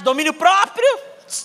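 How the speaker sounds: ordinary talk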